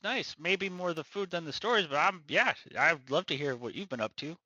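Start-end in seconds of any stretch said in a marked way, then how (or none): none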